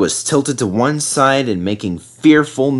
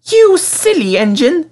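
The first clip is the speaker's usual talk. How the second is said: much higher lady's voice, demanding and louder; demanding refreshment lady; 4 dB louder than usual. A narrator's reading. Refreshment lady scolding Peter Sam for leaving her, demanding. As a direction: play the indignant tea lady. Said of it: "she said".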